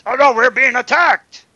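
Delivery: high, loud panicked orc cry. Acted. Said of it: "His orc voice kills me".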